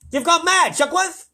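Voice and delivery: loud, very high outburst; furious controller shouting; angry shout. A narrator's reading. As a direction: shout down a madman. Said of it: "shouted ground control".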